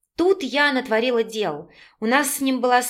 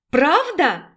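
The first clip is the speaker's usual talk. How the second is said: higher, eager repeated question; excited disbelief; 5 semitones higher, 4 dB louder than usual. A narrator's reading. Raina asks again about her favourite chebureki, excited disbelief. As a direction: ask it brightly, lifting the pitch.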